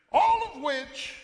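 high, loud emphatic opener; punchy, loud "All of which"; loud build. Acted. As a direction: raise it up before the drop. Sermon builds to a point.

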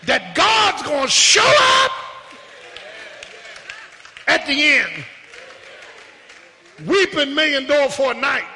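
impassioned pulpit exhortation with bursts of shouting; rally the congregation with conviction; fervent preacher punching each phrase; full-force preaching. Real, not acted.

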